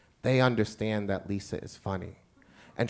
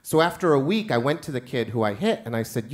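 normal talking voice

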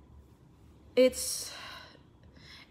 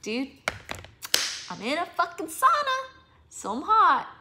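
mocking commenter; 8 semitones higher, 3 dB louder than usual. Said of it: "these comments and it's just like".

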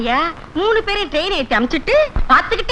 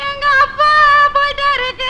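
tearful wail; 7 semitones higher than usual. Acted. Daughter tells neighbour her father died, high tearful wail. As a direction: sob out the bad news theatrically.

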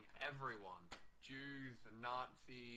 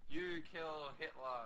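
a hint of an accusing Eva Braun, higher, louder and slow; mock Eva Braun; 6 semitones higher, 8 dB louder, slower than usual. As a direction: drag it out as an accusation. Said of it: "And she's all like".

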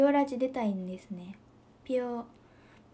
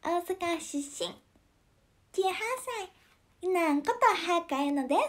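higher, put-on Yuna voice; self-intro as Yuna, pitched up; imitating Yuna; 7 semitones higher than usual. Asked to imitate Yuna, she pitches up. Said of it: "コアくん、ユナくんのモノマネできる".

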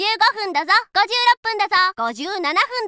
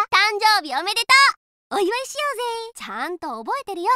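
ordinary talk

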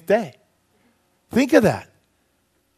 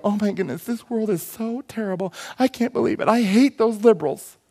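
mock whining; 3 semitones higher than usual. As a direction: imitate a helpless armchair grumbler. Mocks complaining TV news watchers.

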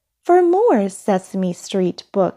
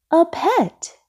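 high, surprised salesperson; raised-pitch exclamation; exclaimed; 10 semitones higher than usual. A narrator's reading. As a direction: pipe up in surprise at a customer. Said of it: "exclaimed the salesperson".

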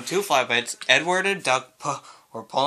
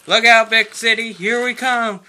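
high, loud rallying cry; excited shout; 9 semitones higher, 9 dB louder than usual. A narrator's reading. Engines set off for the big city, shouting. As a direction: holler it out as you set off.

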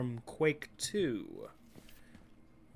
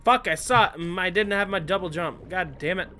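frustrated swearing; 3 semitones higher, 8 dB louder, faster than usual. Real, not acted. Missed jump, swearing at game.

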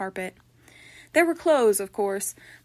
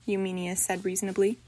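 ordinary delivery

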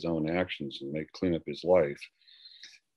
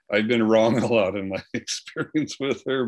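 real laugh through the words, higher and louder; 5 semitones higher, 8 dB louder than usual. Real, not acted. Husband joking about his record with his wife, laughing. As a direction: chuckle loudly while admitting it.

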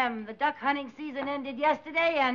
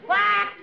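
mallard quack; 5 semitones higher, 4 dB louder than usual. Acted. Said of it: "mallard would be fine".

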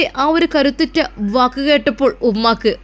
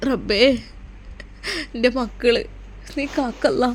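a mother praying through tears, hushed; mother begging God to protect her children, tearful; tearful prayer; 7 dB quieter than usual. Acted. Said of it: "ഉമ്മ കരയണ്ട".

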